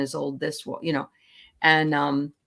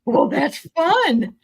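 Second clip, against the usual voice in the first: high, slow delighted gushing; 9 semitones higher, 5 dB louder, slower than usual. Real, not acted. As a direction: gush with pleasure, drawn out. Delighted reaction to story.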